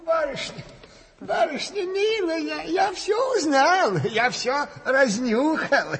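high, breathless-to-tell announcement; excited calling out. Acted. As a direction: burst in with big news. Bursts in calling with discovered news.

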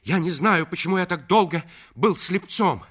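high, loud, rapid outpouring; fervent declaration. Acted. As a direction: pour your heart out in a rush. Lover's fervent declaration to Madeleine. (пылкое, торопливое признание)

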